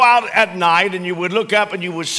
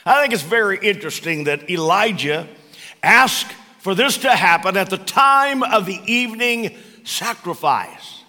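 ordinary talk, nothing marked

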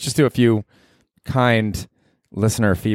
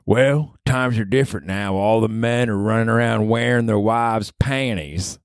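mock old man; old New Hampshire man; 3 semitones higher than usual. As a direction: drawl it like a cranky old-timer. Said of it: "he was just like".

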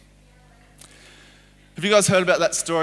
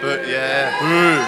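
mock booing the villain, the final boo louder; playful boo; 6 dB louder, much slower than usual. Speaker getting the audience to boo Haman, playful mock boo. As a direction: lead the crowd in booing. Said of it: "this really evil guy called Haman".

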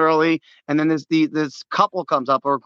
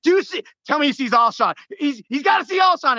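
much higher, urgent cheering; will the receiver open with your voice; excited screaming; 8 semitones higher than usual. Retold or imitated. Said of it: "the last thing I did screaming".

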